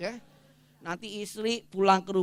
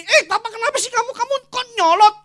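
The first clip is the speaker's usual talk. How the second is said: wife snapping back; 12 dB louder, faster than usual. Retold or imitated. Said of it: "Mama kamu harus gini".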